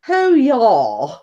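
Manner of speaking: put-on slow, half-hearted Polish accent on broken English; a brief jokey stab at a Polish accent; mock Polish accent. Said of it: "I can't put a Polish on, accent on either".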